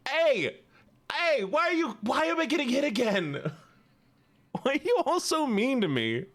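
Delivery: high-pitched wounded protest; mock indignant whine. Streamer complains chat is mean and hitting again, mock indignant. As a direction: whine as if picked on.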